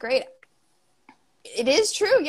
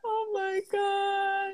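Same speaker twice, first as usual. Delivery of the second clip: stunned; 10 semitones higher, 6 dB quieter, much slower than usual. Real, not acted. After laughing at parole story.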